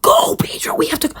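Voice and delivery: high, frantic cry; urgent high-pitched plea; panicked urging. Acted. Bird urges slow turtle on.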